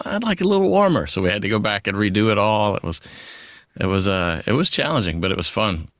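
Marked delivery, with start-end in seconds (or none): 0.0-1.0 high, quick mock boss voice; quoting a boss in a raised, hurried voice; imitating the boss. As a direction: pitch it way up as the picky boss. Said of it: "and the boss says".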